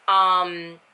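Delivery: ordinary talk, nothing marked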